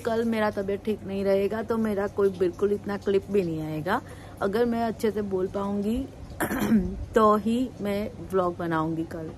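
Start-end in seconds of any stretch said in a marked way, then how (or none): 6.0-7.1 a rough clearing of a sore throat. Real, not acted. Vlogger with a sore throat clearing it mid-talk. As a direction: clear the hoarse throat before going on. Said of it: "आज मेरा गला थोड़ा खराब हो गया है".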